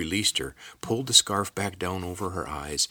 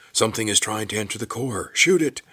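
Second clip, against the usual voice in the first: raised voice building to a high, loud order; calling out; 4 semitones higher, 6 dB louder than usual. A narrator's reading. Captain orders soldiers to shoot at the intruder, calling out. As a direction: call the alarm, then punch out the command. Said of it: "The captain's voice called out".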